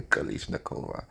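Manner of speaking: breathy unvoiced threat; menacing whisper. Sasha's menacing answer.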